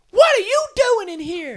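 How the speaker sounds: high, loud, drawn-out cartoon scolding; squeaky wife impression. Wife catching husband in a water fight, mock-angry high voice. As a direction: demand it in a slow Mickey Mouse falsetto. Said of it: "my wife does sound like Mickey Mouse".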